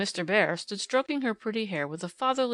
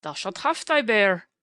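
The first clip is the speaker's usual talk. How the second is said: a louder, hearty German professor; kindly German professor; 5 dB louder than usual. A narrator's reading. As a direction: boom it out, fond and playful, to the child. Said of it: "said the professor".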